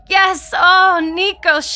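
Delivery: high, loud cry of revelation; rapturous. A narrator's reading. Lydia feigns sudden rapture.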